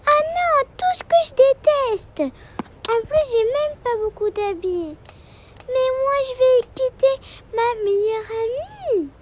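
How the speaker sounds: put-on high, loud childish whine; a little girl protesting about packing; young daughter upset about leaving her best friend, high and loud. Daughter figure complaining.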